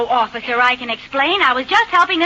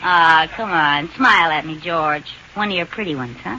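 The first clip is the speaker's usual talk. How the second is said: coaxing; 11 semitones lower than usual. Acted. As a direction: wheedle him into a smile. Wife coaxing husband lowly.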